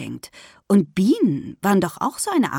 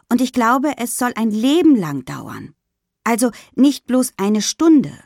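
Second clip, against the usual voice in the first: put-on higher, louder girl's voice, thinking aloud; higher-pitched child reporting to friends; young schoolgirl Lynn; 6 semitones higher, 4 dB louder than usual. A narrator's reading. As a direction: explain it in a high, eager schoolgirl voice.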